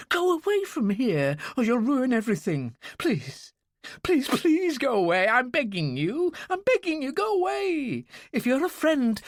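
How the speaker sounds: high, urgent begging that keeps pushing; desperate pleading. A narrator's reading. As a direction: beg him to leave, close to tears. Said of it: "Her voice was low and desperate".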